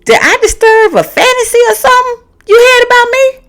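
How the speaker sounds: way up high, mock innocent; high sassy comeback. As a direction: sweetly needle him up high. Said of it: "I was like".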